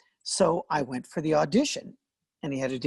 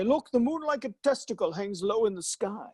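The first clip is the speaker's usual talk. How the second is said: mock Shakespeare; 7 semitones higher than usual. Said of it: "He would get up and say".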